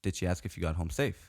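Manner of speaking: low, soft deadpan probe; pointed question. Retold or imitated. Retelling his pointed question to friend.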